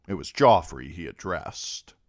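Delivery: ordinary delivery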